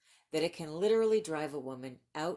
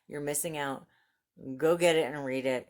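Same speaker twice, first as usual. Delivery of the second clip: ordinary talk, nothing marked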